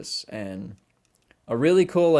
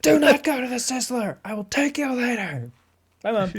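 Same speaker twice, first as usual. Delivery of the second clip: hammed-up yell, much higher and louder; bellowing Do not go to the Sizzler; mock screaming; 13 semitones higher, 4 dB louder than usual. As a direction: scream the order at Mom down the phone.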